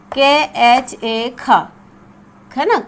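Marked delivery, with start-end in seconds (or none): 0.1-1.5 slow spelling. Shown as a demonstration. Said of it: "ख हो गया".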